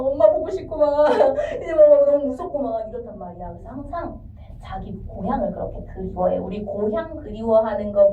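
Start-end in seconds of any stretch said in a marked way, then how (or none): 0.1-2.9 mock crying, high whine with a laugh breaking in; hammed-up tearful whimper; scared homesick kid. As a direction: wail like a child missing mom. Acting frightened homesick young scholars.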